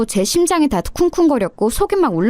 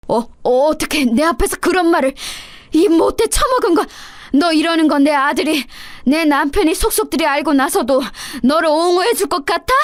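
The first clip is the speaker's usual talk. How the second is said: sputtering, higher and outraged; stammering fury; 5 semitones higher than usual. Acted. Mother-in-law stammering in rage at the insult, furious. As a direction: choke on the words in outrage.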